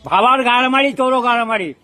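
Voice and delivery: getting angry. Real, not acted. Man told to think harder curses the questioner, getting angry. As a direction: curse back in real anger. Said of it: "তুমি রেগে যাচ্ছ কেন?".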